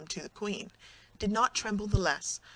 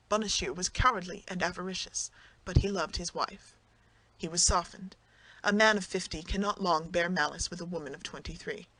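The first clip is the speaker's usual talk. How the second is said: usual speaking voice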